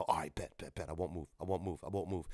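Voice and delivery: low, soft repeated promise; hushed compliance. Retold or imitated. Player in hiding answering teammates, hushed and nervous. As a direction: keep it down so the ghost can't hear.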